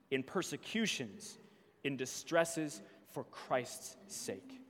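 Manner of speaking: measured, solemn Bible reading; scripture reading. Acted. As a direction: read the passage like a lesson in church. Reads Scripture aloud.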